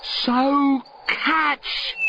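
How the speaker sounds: high called out; calling to the boy below. Acted. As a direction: call it across to the child. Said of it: "calls the Once-ler".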